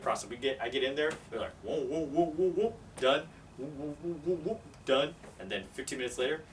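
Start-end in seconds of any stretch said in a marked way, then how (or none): 1.6-5.1 high, repeated whoops ending on "done"; imitated swabbing noises. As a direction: mimic a quick procedure with silly whoops. Imitating testers' quick swabbing motions.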